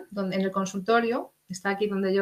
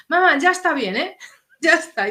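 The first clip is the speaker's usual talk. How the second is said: higher, louder, fed-up son; a hint of a son telling mom enough; exasperated son; 6 semitones higher, 3 dB louder than usual. Son scolds mother about the live stream, higher and louder, exasperated.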